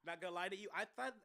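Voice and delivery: ordinary talk, nothing marked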